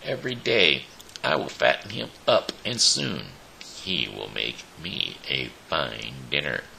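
ordinary delivery

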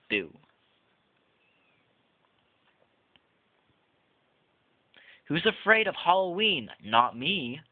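lively, sing-song storybook read-aloud; reading to children. Acted. Reviewer reading the book Boo aloud to viewers, playful storybook voice. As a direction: read it like a Halloween picture book to little kids.

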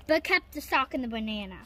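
ordinary delivery, nothing marked

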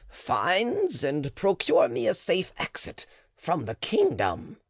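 high, slow, bargaining; high-pitched wary midwife; the matron. Acted. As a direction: hedge and set your terms. Matron voiced in the dialogue.

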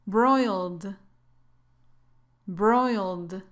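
"Broiled" as one drawn-out syllable, silent e; model pronunciation. Shown as a demonstration. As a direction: say "Broiled" slowly, ending on a plain d. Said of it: "La terminación ed aquí se pronuncia así".

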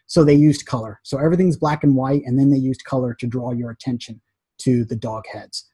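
normal talking voice

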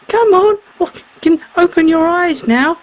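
a lightly high, coaxing voice; shark's voice. A narrator's reading. Shark tells the mermaid, lightly voiced and coaxing. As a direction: gently urge her to open her eyes.

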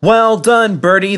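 high, loud encouraging shout; passengers shouting. A narrator's reading. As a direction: cheer him on. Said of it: "they shouted".

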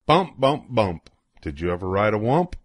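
plain reading voice

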